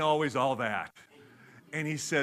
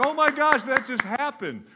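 much higher and louder astonished exclaim; high loud stunned reaction; amazed; 9 semitones higher, 8 dB louder than usual. Retold or imitated. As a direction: gasp it out in disbelief. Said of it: "And we're like".

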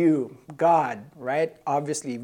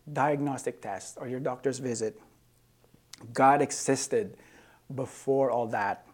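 normal talking voice

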